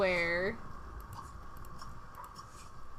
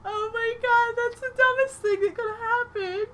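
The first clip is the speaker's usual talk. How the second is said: high, teary laughing disbelief; high breaking voice; laugh-crying; 13 semitones higher than usual. Real, not acted. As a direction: talk through tears of laughter. Laughing at absurd mishap.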